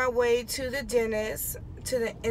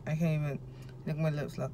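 numb mouth, much softer; numb from the dentist; 4 semitones lower, 9 dB quieter, faster than usual. Real, not acted. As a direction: talk through a numb mouth. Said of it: "My mouth is numb".

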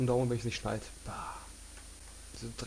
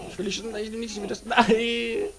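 panicked high cry; 9 semitones higher, 7 dB louder than usual. Real, not acted. About to die again.

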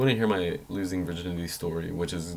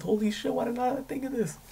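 much higher, squeaky mock amazement. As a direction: gush at your own genius in a squeak. Retelling his own brilliant idea.

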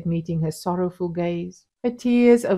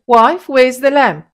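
loud, higher call for the lamp; 7 semitones higher, 11 dB louder than usual. A narrator's reading. Husband calling to his wife for the lamp, loud. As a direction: call out across the hut. Mustapa calls on entering.

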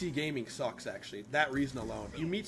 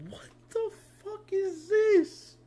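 much higher, louder and slow, stretched in disbelief; baffled outburst; 8 dB louder, much slower than usual. Real, not acted. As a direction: sound truly baffled. Baffled at a book.

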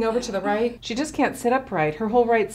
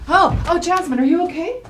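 alarmed; 6 semitones higher, 5 dB louder than usual. Acted. Coworker suddenly sees collapse.

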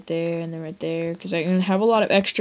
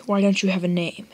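ordinary talk

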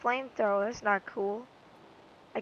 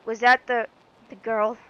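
normal talking voice

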